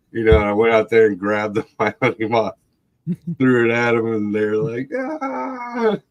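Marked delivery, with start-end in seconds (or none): 4.9-6.0 put-on high, drawn-out kid's shriek; mock terrified scream. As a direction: imitate children screaming in fright. Little brothers scream at thrown moth.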